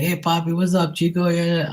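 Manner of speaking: high-pitched mock hailing voice; friendly Bostonian greeting. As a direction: imitate locals greeting you, pitched well up. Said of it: "They're like".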